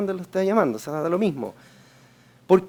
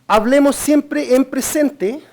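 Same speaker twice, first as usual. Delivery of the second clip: slow, higher and louder, each word weighted; emphatic rule; 6 semitones higher, 5 dB louder, much slower than usual. Real, not acted. Instructor stressing the rule to phone agents. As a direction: lay the rule down slowly and firmly.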